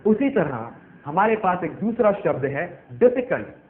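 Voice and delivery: ordinary talk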